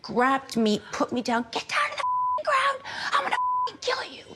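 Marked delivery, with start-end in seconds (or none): 1.5-4.2 higher mock yell of the gunman, fast at the end; hammed-up threat from an attacker; imitating gunman. Retelling the gunman's threat in a high mock yell. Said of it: "he charged at me".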